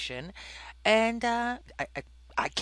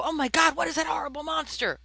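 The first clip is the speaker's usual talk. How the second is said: mock shout; 10 semitones higher, 4 dB louder, faster than usual. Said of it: "if you happen to hear this croaking noise and go".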